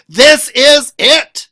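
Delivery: ordinary delivery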